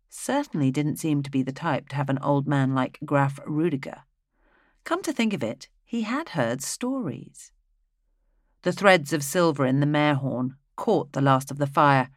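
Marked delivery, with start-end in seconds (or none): none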